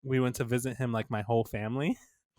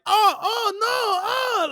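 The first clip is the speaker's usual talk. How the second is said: high, loud mock cries of alarm; mock panicked wail; 6 dB louder than usual. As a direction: wail in fake pain as a prankster. Acting out grandpa's fake injury.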